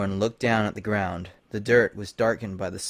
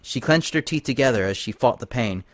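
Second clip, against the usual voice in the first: ordinary delivery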